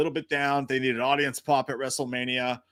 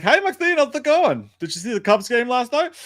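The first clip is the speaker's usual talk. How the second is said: high, loud breezy small talk; bored actress making small talk; casual chit-chat voice; 10 semitones higher, 6 dB louder than usual. Retold or imitated. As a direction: chat away like nothing is happening. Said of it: "she's just like".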